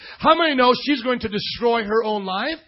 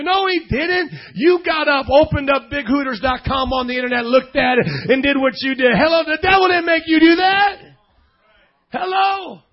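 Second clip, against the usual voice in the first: full-force preaching; 4 semitones higher, 4 dB louder than usual. Real, not acted. Preacher to congregation on you and God, full-force preaching. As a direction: drive it home with stretched key words.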